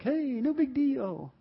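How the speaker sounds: high, drawn-out mock nonchalance; slowly singsong it like a carefree kid; breezy teen mimicry. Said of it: "You were like".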